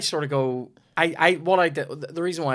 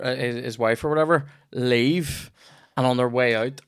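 ordinary talk, nothing marked

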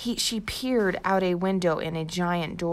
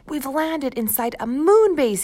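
higher and louder, thrilled; excited; 8 semitones higher, 6 dB louder than usual. Acted. Jack tells Annie where they landed, excited and louder. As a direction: announce the big discovery, thrilled. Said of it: "Jack's heart pounded with excitement".

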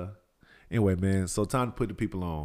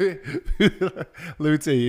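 laughing; 7 semitones higher, 4 dB louder, much slower than usual. Real, not acted. Teasing through laughter.

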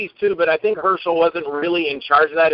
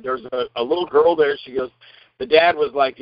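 ordinary delivery

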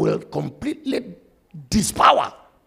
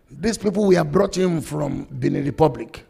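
normal talking voice

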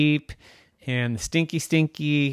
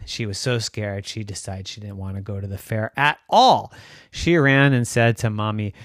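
plain reading voice

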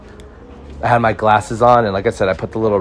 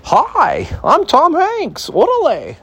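much higher, slow put-on street-tough voice; Tom Hanks as street thug; much slower than usual. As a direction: squeak out a silly gangster intro. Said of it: "You think Tom Hanks is gonna take a role on as a... street thug".

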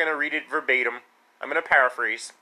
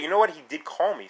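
usual speaking voice

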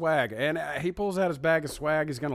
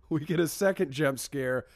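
ordinary delivery

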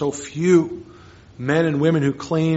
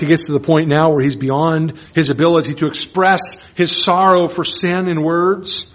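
ordinary talk, nothing marked

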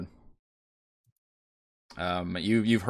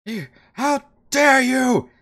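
high, slow outraged cry; indignant creature voice; 6 dB louder, slower than usual. Acted. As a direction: hurl it back in offended disbelief. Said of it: "the other figure respond".

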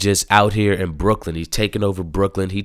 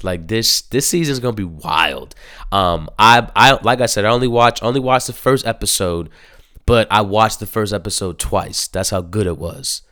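ordinary talk, nothing marked

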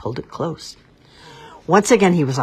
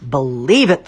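much louder booming ogre; thundering ogre; 8 dB louder than usual. A narrator's reading. Ogre thundering at the doubtful cat, loud. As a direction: thunder the words at Puss. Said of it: "thundered the ogre".

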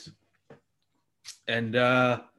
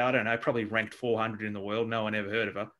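ordinary talk

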